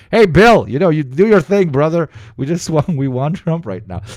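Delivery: loud, high mock voice telling Bill he's free to go; mock crowd voice. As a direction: play the crowd shouting a cheerful okay to Bill. Voicing people talking to Bill.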